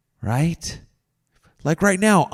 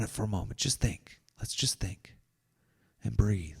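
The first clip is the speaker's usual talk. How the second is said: whisper; 7 semitones lower, 13 dB quieter than usual. Real, not acted. Calming viewers into a pause.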